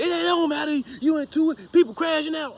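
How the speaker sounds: high, loud imitation of an insistent relative; high-pitched mock protest; mock arguing back. Retold argument back from relatives.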